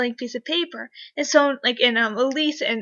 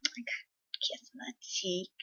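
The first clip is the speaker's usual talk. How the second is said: whispered aside; 3 semitones lower, 17 dB quieter than usual. Real, not acted. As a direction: whisper it as a thrilled secret. Aside thrilled about the kiss.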